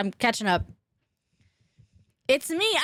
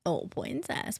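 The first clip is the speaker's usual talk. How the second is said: softer, slow tender oh princess; soft coo to pet; 5 semitones lower, 8 dB quieter, slower than usual. Real, not acted. Streamer softly coos at her pet princess, tender.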